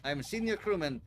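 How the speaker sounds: put-on loud, proud Russian-accented sailor. Senior crewman introducing himself to the visitors, loud Russian accent. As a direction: boom it out in a heavy Russian accent. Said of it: "Russian sailor character voice".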